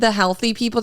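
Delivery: normal talking voice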